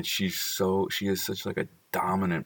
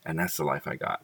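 usual speaking voice

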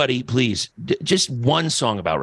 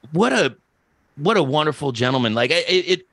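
ordinary delivery

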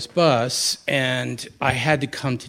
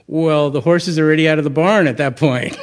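quoting the teacher with a laugh, louder; 4 semitones higher, 8 dB louder than usual. Retold or imitated. Retelling his teacher's wry answer, laughing. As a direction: chuckle through the punchline.

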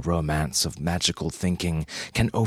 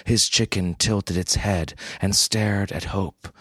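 ordinary delivery, nothing marked

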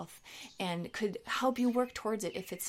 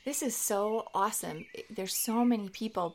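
ordinary talk

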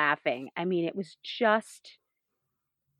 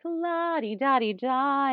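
high, slow sing-song; breezy, floating without a care; carefree la-di-da; 7 semitones higher, slower than usual. Retold or imitated. Mimicking the relaxed groom, a high slow sing-song la-di-da. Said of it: "kind of attitude".

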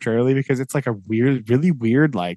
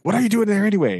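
suspicious Sam; 8 semitones higher, faster than usual. Retold or imitated. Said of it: "and he's like".

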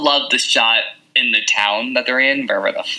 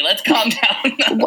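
laughing tease; 10 semitones higher, much slower than usual. Real, not acted. Teasing slowdown with a laugh.

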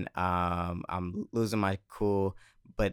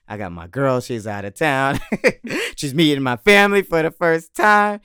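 louder and higher as it builds; retold heated protest; 6 semitones higher, 10 dB louder than usual. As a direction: build to an exasperated shout, laugh breaking through. Retells his protest to the bouncer.

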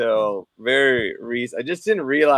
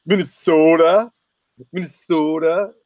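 mock thick Minnesotan; 3 semitones higher, 4 dB louder than usual. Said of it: "If I talked like that".

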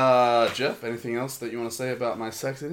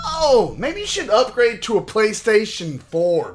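mocking; 10 semitones higher, 8 dB louder than usual. Mocking console fans.

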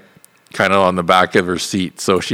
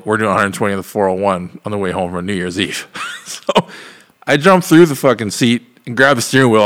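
usual speaking voice

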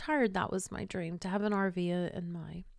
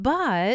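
"But" loud, raised and drawn out; "But" punched high, leaving more to come; infinite "But"; 5 semitones higher, 8 dB louder than usual. Retold or imitated. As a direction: hang a big loaded pause on "But". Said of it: "you know, that infinite but".

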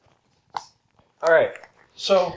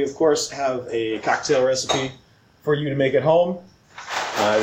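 ordinary talk

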